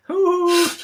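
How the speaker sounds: high comic Irish hoot; Irish accent. Shown as a demonstration. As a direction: whoop into a stage-Irish voice. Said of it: "I start off with the".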